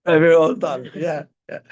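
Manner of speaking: ordinary talk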